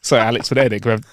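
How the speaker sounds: ordinary talk, nothing marked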